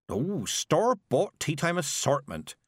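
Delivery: slow, a hint of disdain; mock-impressed old woman; sniffy old witch. Weatherwax voiced.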